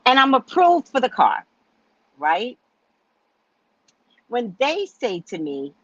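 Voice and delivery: ordinary delivery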